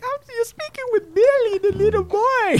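put-on very high little-boy voice; hammed-up falsetto kid voice; imitating Billy. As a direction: squeak like a scared small child. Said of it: "pretending to be a scared little boy".

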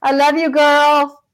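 high, drawn-out love you; affectionate. Real, not acted. Telling sister Vicky on screen she loves her, fond. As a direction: beam it at her, slow and fond.

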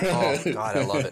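ordinary talk, nothing marked

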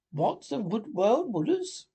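high, startled cry; mildly panicked exclamation; trembling alarm. A narrator's reading. Toad hearing of the Wild Wooders, crying out alarmed. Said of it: "cried Toad, trembling in every limb".

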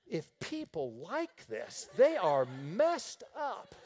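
slow disgusted drawl; mock horror at the taste. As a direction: savor the disgust slowly. Disgust at first beer.

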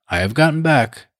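hint of an east wind in the voice; bleak Lady Malvern. A narrator's reading. The aunt coldly greeting Bertie, a touch bleak. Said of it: "something sort of bleak about her tone".

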